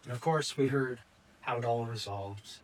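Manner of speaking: ordinary delivery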